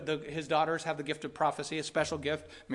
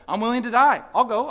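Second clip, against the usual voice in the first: louder and higher, Paul's firm vow; resolute declaration; 6 semitones higher, 9 dB louder than usual. Retold or imitated. Voicing Paul's resolve to die.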